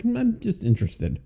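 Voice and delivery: soft, high musing; lightly pondering to oneself; retold curiosity. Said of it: "I was like".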